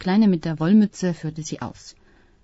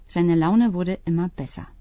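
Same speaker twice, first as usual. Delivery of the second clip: ordinary delivery, nothing marked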